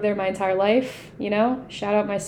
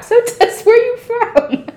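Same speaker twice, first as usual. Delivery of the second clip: much higher and louder, like a TV host; hammed-up host voice; 12 semitones higher, 7 dB louder than usual. Shown as a demonstration. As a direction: replay the question as an over-the-top announcer. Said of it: "One more time".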